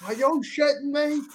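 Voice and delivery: high-pitched mock disbelief; mad dad. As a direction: imitate an outraged father challenging the clerk. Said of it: "why is he so mad".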